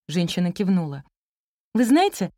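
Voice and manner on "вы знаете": higher, pleased praise; gladdened. A narrator's reading. Teacher praising a daughter to her mother, delighted. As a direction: seize on it with delight. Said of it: "обрадовалась учительница".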